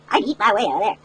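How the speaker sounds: ordinary delivery, nothing marked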